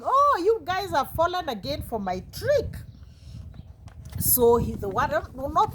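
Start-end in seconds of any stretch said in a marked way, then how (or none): 0.0-2.7 higher-pitched playful gloat; amused, mocking. A narrator's reading. Boy amused that villagers fell for it, mildly mocking. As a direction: tease them with a lifted voice. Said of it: "he was laughing and saying".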